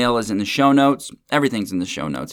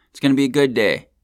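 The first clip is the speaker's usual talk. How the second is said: ordinary talk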